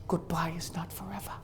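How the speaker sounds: a hushed, breathy farewell whisper; soft whispered parting line. Retold or imitated. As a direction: breathe it out as a last goodbye. Husband's parting words, retold.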